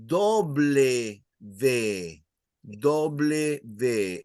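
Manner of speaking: slow Spanish "doble V"; "doble V" drawn out, Spanish "dobleh veh". Shown as a demonstration. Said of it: "sometimes people would say".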